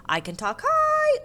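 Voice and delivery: sing-song line leaping very high on "high"; talking high. A narrator's reading. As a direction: send "high" way up in pitch. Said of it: "thinking about high and low and start to sing".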